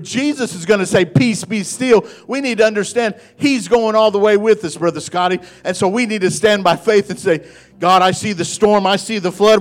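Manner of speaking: rally the congregation to stand firm; full-force preaching. Real, not acted. Preacher urges church to stand by faith, full-force preaching.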